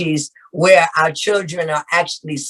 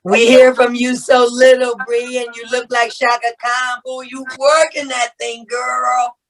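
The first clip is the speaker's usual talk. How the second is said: excited hype compliments; 7 semitones higher than usual. Real, not acted. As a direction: hype her up like a proud grandma. Greeting and complimenting Bree.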